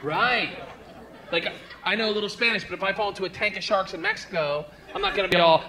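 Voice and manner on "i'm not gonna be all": ordinary talk, nothing marked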